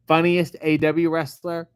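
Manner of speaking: usual speaking voice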